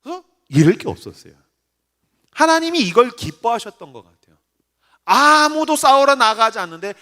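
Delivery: ordinary delivery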